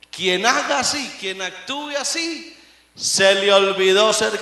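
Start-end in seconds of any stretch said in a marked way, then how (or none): none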